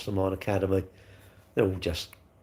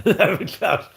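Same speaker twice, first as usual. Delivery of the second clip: laughing emphatic repeat; 7 semitones higher, 4 dB louder, slower than usual. Shown as a demonstration. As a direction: lower class again with a laugh, higher.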